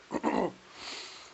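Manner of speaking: harsh hacking cough; coughing fit. Real, not acted. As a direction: cough hard, out of control. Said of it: "try not to cough".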